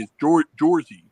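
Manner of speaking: loud Jersey as "JOR-zee"; Jersey pronunciation. Shown as a demonstration. Giving another local example, Jersey as "JOR-zee". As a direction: round the first vowel of Jersey to "or". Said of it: "and then from Jersey".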